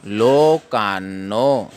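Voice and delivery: लोकान्नो slow, with a held double न; half न sounded. Shown as a demonstration. Teacher showing the hidden half न, slow and deliberate. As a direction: stretch लोकान्नो as लो-कान्-नो. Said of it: "लोकान्नो पढ़ना है".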